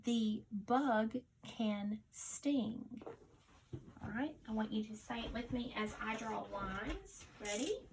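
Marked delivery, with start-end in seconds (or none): 0.0-3.0 slow, even repeat of the dictation sentence; teacher repeats the dictation sentence slowly. Shown as a demonstration. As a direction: say it again, just as slow. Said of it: "Listen again".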